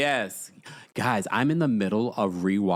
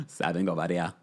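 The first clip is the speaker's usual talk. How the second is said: lower, playful take on the name Simon; imitating Simon's voice; 7 semitones lower than usual. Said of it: "I can't even get to the register that he does".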